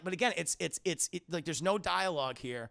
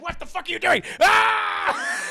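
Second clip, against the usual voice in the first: Palpatine screams; 13 semitones higher, 13 dB louder than usual. Retold or imitated. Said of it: "Palpatine's like".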